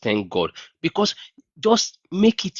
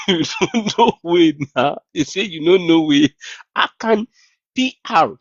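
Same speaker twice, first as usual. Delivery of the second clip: giggling, mocking tease; laughing mockery; 5 semitones higher, 3 dB louder than usual. Real, not acted. Mocking the scammer's followers, laughing. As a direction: tease them through a laugh.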